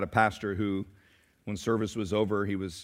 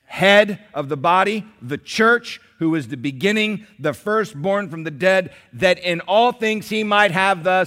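slow, loud, drawn-out reading; slow scripture proclamation; 7 semitones higher, 10 dB louder, slower than usual. Acted. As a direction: lift every word of the verse.

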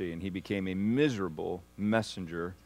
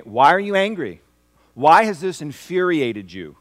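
louder, higher, slowed question; loud pleading question; 6 semitones higher, 8 dB louder than usual. Acted. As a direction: press him on his anger.

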